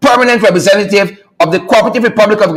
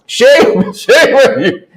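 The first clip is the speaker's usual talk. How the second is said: laughing; 3 semitones higher, much slower than usual. Real, not acted. Laughing scold.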